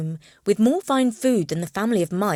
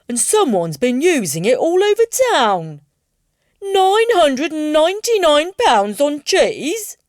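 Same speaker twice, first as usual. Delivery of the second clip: louder, higher outraged customer; loud indignant complaint; 7 semitones higher, 8 dB louder than usual. Acted. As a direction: complain loudly about the charges. Customer complaining about stolen card.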